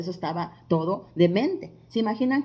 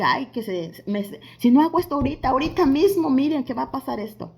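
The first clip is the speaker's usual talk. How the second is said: rushed, louder mock panic, pitched up; impatient urgency; 4 semitones higher, 5 dB louder, faster than usual. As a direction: blurt it out like someone who can't wait. Acts out an impatient believer.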